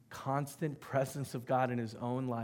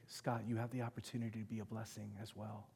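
soft inner voice; 3 semitones lower, 11 dB quieter, faster than usual. Retold or imitated. God's voice in his heart.

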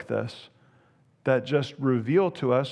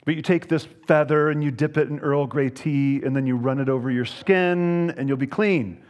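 usual speaking voice

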